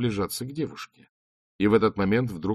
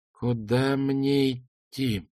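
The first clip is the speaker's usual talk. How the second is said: very slow, hollow, eerie question; a slowed, ghostly, unsettling voice; sepulchral voice; 6 semitones higher, much slower than usual. Acted. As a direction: ask it from beyond the grave. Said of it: "загробным голосом, глубоким и тревожащим".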